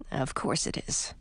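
low, unvoiced mutter under the breath. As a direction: grumble it almost silently. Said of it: "muttered Edric".

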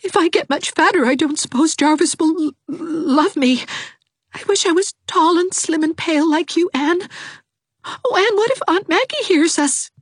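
put-on weepy young girl; tearful Dovey. A narrator's reading. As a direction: wail her misery.

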